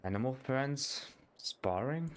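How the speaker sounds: ordinary talk, nothing marked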